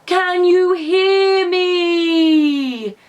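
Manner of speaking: Percy calling down to the rabbit, slow and loud; calling down a well. A narrator's reading. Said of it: "called Percy".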